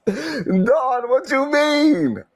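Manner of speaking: high, slow, mock-offended; sassy put-on voice. Gossip answers Don, high slow sassy voice.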